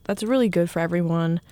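ordinary talk

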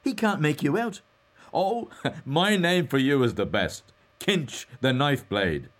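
high, loud teasing with a chuckle; gleeful banter. A narrator's reading. Mulligan gives Stephen his nickname Kinch, gleeful banter.

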